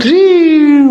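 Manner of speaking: high, loud squeal; yelp. Acted. Madhumangal being kicked back, high loud yelp. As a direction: let out a sudden high squeal of pain.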